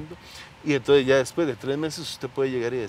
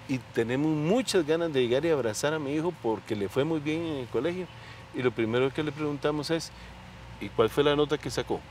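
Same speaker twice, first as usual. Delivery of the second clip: ordinary talk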